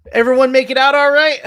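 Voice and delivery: loud, high, quick holler; guard calling out. Acted. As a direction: shout a check-in to the people in the van. Guard calls to arriving second vehicle.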